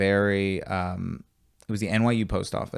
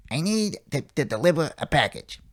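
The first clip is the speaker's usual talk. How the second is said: put-on old lady voice; 4 semitones higher than usual. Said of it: "Such a, such a specific voice".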